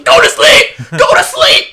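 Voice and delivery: huge, very high mock shout; joking about basketball giants screaming a kid to sleep, mock shouting; giant yelling. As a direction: bellow it like a giant looming over a crib. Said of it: "busting your room".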